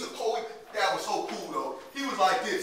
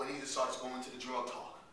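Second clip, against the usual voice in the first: ordinary talk, nothing marked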